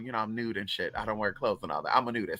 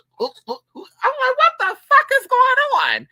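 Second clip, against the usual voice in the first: very high, loud mock alarm; incredulous outburst; 7 dB louder than usual. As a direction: squawk it in comic disbelief. Retelling alarm at her hiccuping.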